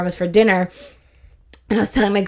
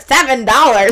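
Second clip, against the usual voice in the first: loud, incredulous, edged with laughter; mock outrage; 3 semitones higher, 7 dB louder than usual. Customer reacting to the nail salon's price, mock outrage. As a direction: exclaim it loudly in comic disbelief.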